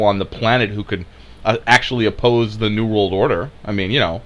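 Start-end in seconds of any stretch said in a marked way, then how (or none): none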